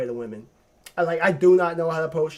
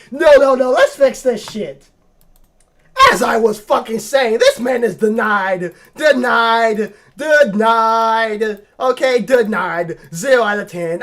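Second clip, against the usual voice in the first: loud; 6 semitones higher, 11 dB louder, slower than usual. Real, not acted. Fixing the approve mistake loudly.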